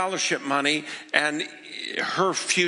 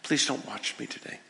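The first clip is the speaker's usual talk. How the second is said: soft, low private plea to God; understated whispered plea, voice lowered; hushed retold whisper; 5 semitones lower, 7 dB quieter than usual. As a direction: pray it quietly, lightly imitating a whisper. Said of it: "she just whispers to God".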